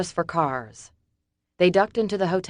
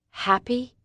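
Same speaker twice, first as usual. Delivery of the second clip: higher scoffing echo of "Happy"; 8 semitones higher than usual. A narrator's reading. Summer scoffing at David's offer. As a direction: scoff at the word. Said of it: "She scoffed".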